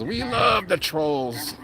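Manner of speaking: slow, high mock quote of the guy; playful high-pitched parody, very slow; imitating him. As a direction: drag it out in a high put-on voice. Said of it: "where he says".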